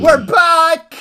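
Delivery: excited shout. Real, not acted. Cheering return of the show.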